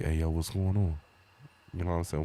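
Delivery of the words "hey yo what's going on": smooth flirty phone voice; sexy phone voice. Pretend. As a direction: put on the sexy voice for her. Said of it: "With my sexy voice on".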